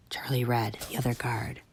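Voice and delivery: soft furtive whisper. Acted. Tank whispering to the kids, secret whisper. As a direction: keep it hushed.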